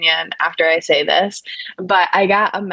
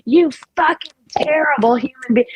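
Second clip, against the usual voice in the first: much higher, hammed-up disgust; mock outraged troll; 8 semitones higher than usual. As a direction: sneer it like an offended chatter. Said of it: "They're like".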